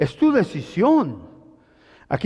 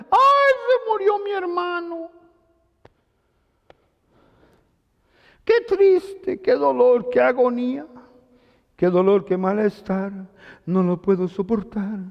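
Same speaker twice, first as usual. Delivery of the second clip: much higher, whiny mock grief that sinks at the end; mock lament; 4 semitones higher than usual. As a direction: parody a grieving sister, overdone. Mocking Marta's lament for brother. (lamento burlón, agudo y quejumbroso)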